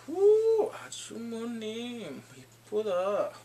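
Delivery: high-pitched whoop into cooing compliment; playful admiration. Retold or imitated. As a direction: gush in a high voice, then ease down. Acts out admiring the supervisor.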